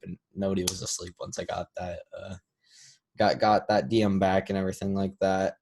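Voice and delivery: ordinary delivery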